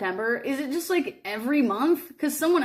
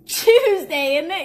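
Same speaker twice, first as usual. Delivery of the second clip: mock British accent; 5 semitones higher, 6 dB louder, slower than usual. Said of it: "couldn't take it seriously".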